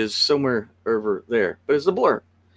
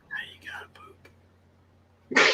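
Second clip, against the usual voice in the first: slow, much higher whisper; teasing whisper; 7 dB quieter, much slower than usual. Pretend. A co-host whispering a joke about the bathroom to her partner. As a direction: tease him under your breath.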